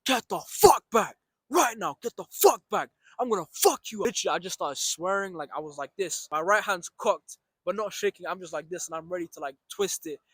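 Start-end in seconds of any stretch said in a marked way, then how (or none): 0.0-4.1 high, forceful retold yell; mock angry shouting at an attacker. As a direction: bark the threats like you mean it. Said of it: "I shouted and I shouted loud, angrily".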